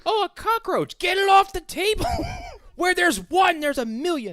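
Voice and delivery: high, shrill-voiced panic at a cockroach; hammed-up freakout over a cockroach; panicked shriek. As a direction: shriek it like someone who just saw a roach on the table. Acting out cockroach scene panic.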